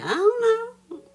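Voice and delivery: very high, loud, drawn-out mock innocence; mock-innocent falsetto shout; mimicked clueless answer. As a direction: squeal it slowly, imitating him playing dumb. Mimics his answer about the flour.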